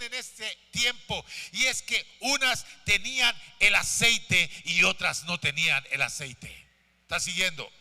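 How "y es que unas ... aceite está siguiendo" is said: loud, driving sermon delivery; impassioned preacher building in volume; full-force preaching. Real, not acted. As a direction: project to the whole church, hammering each phrase.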